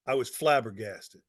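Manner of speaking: ordinary delivery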